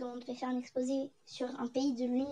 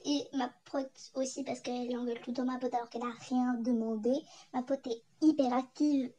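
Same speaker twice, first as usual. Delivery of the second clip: usual speaking voice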